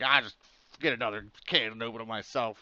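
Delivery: loud, exasperated outburst; angry. Retold or imitated. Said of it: "like, really angry".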